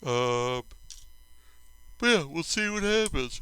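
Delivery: talking through a yawn; words stretched by a yawn. Real, not acted. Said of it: "I'm yawning here".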